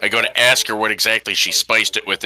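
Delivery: normal talking voice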